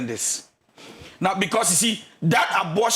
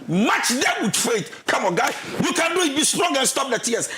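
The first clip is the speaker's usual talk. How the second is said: rousing urging; 6 semitones higher than usual. Retold or imitated. God urging Joshua forward.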